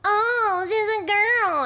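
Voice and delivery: high, drawn-out taunting singsong; mocking male gamers. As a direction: mimic players jeering at a girl. Mimics male players hearing a girl.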